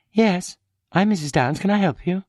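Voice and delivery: lightly voiced muddled housewife on the phone; confused wife. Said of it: "Her manner was rather endearingly confused".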